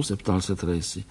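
ordinary delivery, nothing marked

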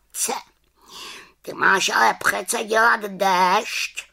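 high, scolding raven voice; reproachful raven croak. A narrator's reading. Raven scolding the little witch over the rain, reproachful croak. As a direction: croak it reproachfully, pitched well up. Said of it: "krákal vyčítavě".

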